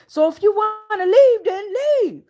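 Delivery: high, loud mock yell from the backyard; retold yell. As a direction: throw it out like an angry partner. Overheard couple arguing in backyard.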